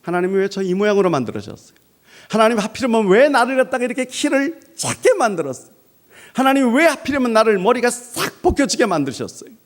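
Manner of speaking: high whiny complaint to God; complaining to God. Pretend. 외모를 두고 하나님께 불평하는 사람, 높고 징징대는 투정. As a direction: whine at God about your looks. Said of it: "마음속에 불평이 있는 거예요".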